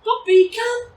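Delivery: high, loud, light call to the puppy; excited recall. Shown as a demonstration. Trainer showing how to call the puppy, excited high call. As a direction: make coming to you sound like a party. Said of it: "notice the excited tone of voice when I call her".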